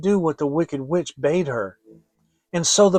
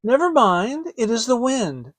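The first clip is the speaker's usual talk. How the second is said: put-on children's reply; 8 semitones higher, 3 dB louder than usual. Children answer the witch.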